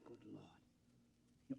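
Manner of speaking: breathed, barely voiced; hearing of the plot, a stunned whisper; shocked whisper. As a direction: horror under the breath.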